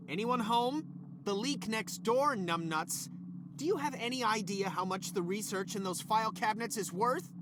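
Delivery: ordinary talk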